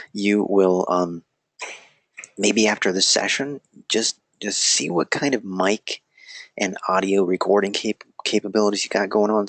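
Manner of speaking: normal talking voice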